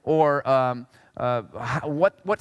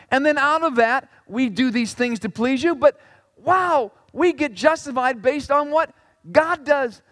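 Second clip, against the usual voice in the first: ordinary talk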